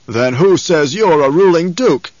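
loud, slow, defiant challenge; forceful, drawn-out demand. A narrator's reading. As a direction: throw the challenge back at a duke. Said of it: "the man demanded".

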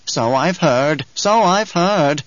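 slow, high, wheezy crone voice; wheezing old crone. Acted. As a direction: drag it out like an old witch. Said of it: "wheezed the old woman".

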